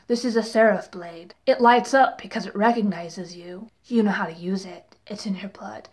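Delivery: low, hushed, fading to near whisper; mock solemn explainer. Mimicking Jace explaining the blade to Clary, mock solemn. Quoting Jace's repeated explanation.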